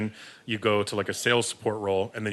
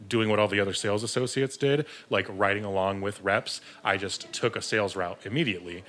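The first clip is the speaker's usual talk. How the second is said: ordinary talk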